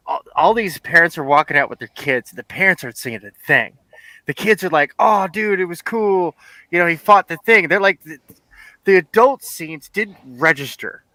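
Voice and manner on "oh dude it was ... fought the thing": higher, enthused kid impression; imitating a thrilled youngster; excited kid. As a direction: gush like a kid after a movie. Voices excited kids after the movie.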